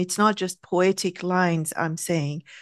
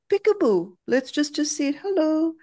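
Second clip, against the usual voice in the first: put-on high, playful sing-out, like peekaboo with a child; childlike playful; 7 semitones higher than usual. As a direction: coax it out playfully in a higher voice. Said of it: "go like a child. Become playful".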